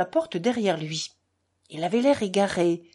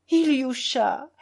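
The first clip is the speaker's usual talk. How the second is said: high, slow, drawn-out wail of the name; tearful cry; 6 semitones higher, 4 dB louder, slower than usual. A narrator's reading. Mother in tears calls to her son, crying out. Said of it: "s'écria-t-elle".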